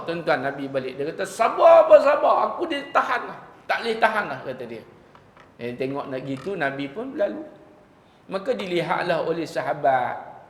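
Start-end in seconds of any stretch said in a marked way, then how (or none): 1.2-4.4 high, loud angry snap at being told to be patient; angry retort. Retold or imitated. Preacher voicing the angry woman answering the Prophet, high loud anger. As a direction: fire back at the Prophet in a temper. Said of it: "Dia marah".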